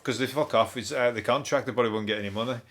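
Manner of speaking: ordinary delivery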